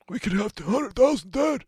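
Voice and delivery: high-pitched, put-on panicky voice; mock alarmist. Mocking alarm over death figures.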